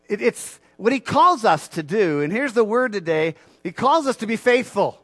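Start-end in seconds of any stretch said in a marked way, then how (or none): none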